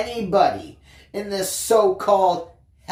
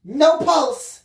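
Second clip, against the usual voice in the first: higher, louder clipped nurse report; 8 semitones higher, 4 dB louder than usual. Acted. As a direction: nurse announces no pulse, urgent. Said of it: "she reported".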